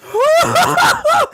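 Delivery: high, loud whoop of laughter; hard laughter. Real, not acted. Streamer can't stop laughing at rival, loud high burst. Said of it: "I ain't laughed this hard".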